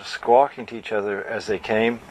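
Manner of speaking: usual speaking voice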